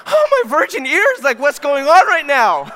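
high, loud hammed-up horror; mock scandalized. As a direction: cry out as if your innocent ears are offended. Acts out his scandalized reaction.

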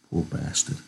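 soft. Acted. Candy grieves over the dead girl, soft. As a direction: murmur it over the body. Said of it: "he said softly".

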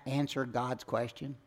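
ordinary talk, nothing marked

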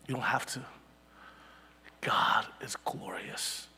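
slow, unvoiced whisper of awe; slow reverent whisper. Real, not acted. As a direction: breathe it out slowly in wonder. Quiet sermon close.

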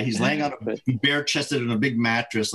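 ordinary talk, nothing marked

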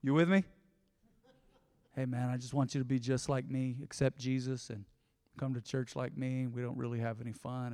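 a gloomy believer inviting a friend; mock joyless evangelist. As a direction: sell it with zero enthusiasm. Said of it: "Can you imagine me going up to someone going".

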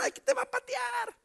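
high shouted warning; mock shout. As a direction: yell the threat for laughs. Warning about police kicking.

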